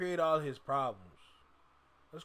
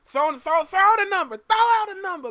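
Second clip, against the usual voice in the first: much higher, louder call to the chat; rally the viewers to shout out numbers; excited calling out; 13 semitones higher, 8 dB louder than usual. Real, not acted. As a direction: streamer hyping up chat for guesses.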